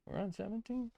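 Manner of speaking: high, incredulous question; surprised. Real, not acted. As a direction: squeak it out in disbelief. Surprised at the number.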